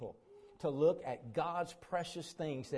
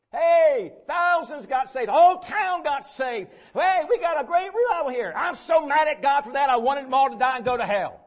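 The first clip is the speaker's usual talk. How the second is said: hammed-up angry yelling, much higher and louder; mock furious shout; 10 semitones higher, 14 dB louder than usual. Jonah yelling at God about the whole town saved, mock furious shout. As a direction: bellow it like a sulking prophet raging at God. Preacher mocks Jonah's anger at revival.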